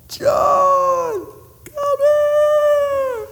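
high shouted call across water. Acted. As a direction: hail John from the boat. Calling to partner on shore.

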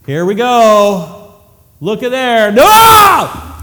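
slow build to a high shouted No; startled shout. Acted. Peter shocked as the nets overflow, shouting. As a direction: gasp as the nets fill.

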